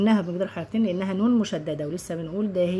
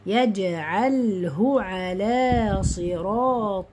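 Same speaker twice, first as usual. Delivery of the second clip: slow, drawn-out tajweed chant; Quran recitation; 2 semitones higher, much slower than usual. Shown as a demonstration. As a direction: recite with long held vowels and nasals.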